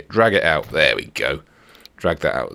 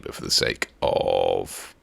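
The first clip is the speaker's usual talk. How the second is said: ordinary talk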